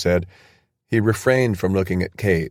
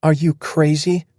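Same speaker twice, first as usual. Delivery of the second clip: incredulous; 9 semitones higher than usual. Acted. Kate's shocked outburst.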